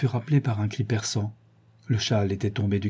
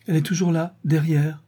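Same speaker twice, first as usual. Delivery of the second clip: anxious; 6 semitones higher than usual. A narrator's reading. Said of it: "poursuivit-elle avec anxiété".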